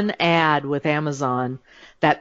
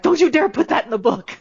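much higher laughing "don't you dare"; laughing mock warning; 11 semitones higher than usual. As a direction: play cousins begging her half laughing. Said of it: "they're like".

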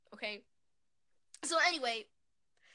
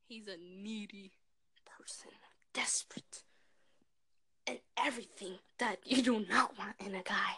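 hushed whisper; 3 semitones lower, 7 dB quieter than usual. Real, not acted. Confiding judgment of boyfriend.

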